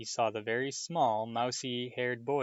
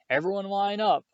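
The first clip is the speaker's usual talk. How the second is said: much higher and louder roar to a crowd; 9 semitones higher, 7 dB louder than usual. A narrator's reading. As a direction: bellow it across the courtyard. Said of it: "Malfoy roared to the crowd".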